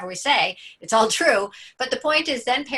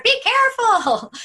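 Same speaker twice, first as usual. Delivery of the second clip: anxious parent warning; 13 semitones higher, 8 dB louder than usual. Retold or imitated.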